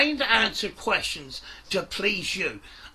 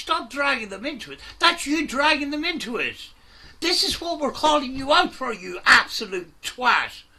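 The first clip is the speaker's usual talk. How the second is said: loud, higher, furious scolding; angry rant; 4 semitones higher, 4 dB louder than usual. Real, not acted. Host yelling at Cecil across the livestream, furious. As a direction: lay into him with full anger.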